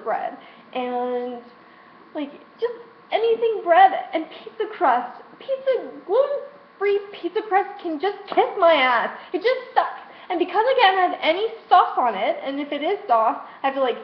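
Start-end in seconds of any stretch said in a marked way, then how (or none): 2.1-12.1 much higher, crying while ranting; upset near tears, pitched high; real tearful rant. Real, not acted. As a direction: voice cracking with frustration. Tearful rant about gluten-free crust.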